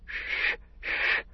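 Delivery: unvoiced hissing shh; hissed shush. A narrator's reading. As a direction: hush them with a sharp hiss. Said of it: "hissed Gollum".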